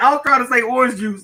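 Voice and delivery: normal talking voice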